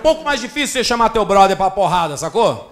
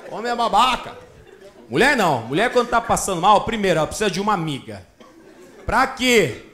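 usual speaking voice

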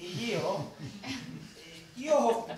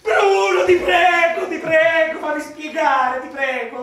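loud, high begging shout; desperate pleading; 3 semitones higher, 9 dB louder, slower than usual. Acted. Friend caught with the wife begging Bruno, shouting desperately. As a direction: beg him not to shoot.

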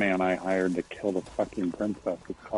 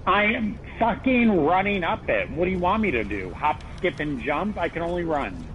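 exasperated; 9 semitones higher than usual. Real, not acted. Frustrated player running up tower.

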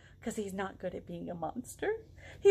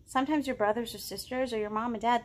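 ordinary delivery

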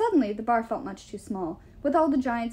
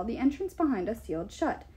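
usual speaking voice

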